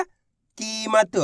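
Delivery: कीमत drawn out very slowly, syllable by syllable; stretched word. Shown as a demonstration. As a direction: slowly sound out कीमत so each letter is heard. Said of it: "कीमत के अंदर पहला अक्षर". (कीमत को धीरे-धीरे खींचकर बोलना)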